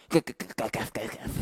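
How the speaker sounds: stammered, whispering softly; timid servant calling Kaf in a whisper. Acted. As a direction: barely dare to call. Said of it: "karena suaramu nanti".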